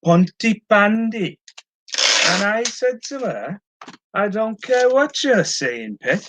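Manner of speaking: high, slow, sing-song Welsh accent. Shown as a demonstration. Seller does requested Welsh accent for live viewers, playful Welsh accent. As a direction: put on a lilting, drawn-out Welsh accent. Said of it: "Do a Welsh accent".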